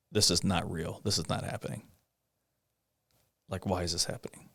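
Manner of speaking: softly, half to himself, in disbelief; stunned quiet disbelief, dropping away; quiet inner thought. Retold or imitated. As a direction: murmur it like you can't believe it. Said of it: "I just remember thinking".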